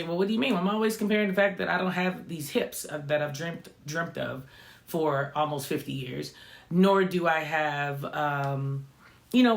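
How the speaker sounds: normal talking voice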